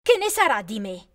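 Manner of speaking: high, loud accusing cry; loud, high furious outburst; angry shout. A narrator's reading. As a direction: fling the insult at her sister. Sisters quarrel.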